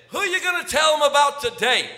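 put-on high, loud teasing question to a child; bright loud question to a kid; playful call. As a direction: tease him loudly, grinning.

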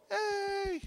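small, high, soft crowd cheer; half-hearted cheer. Retold or imitated. Class voting for another runner, lukewarm high cheer. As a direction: imitate a feeble little hooray.

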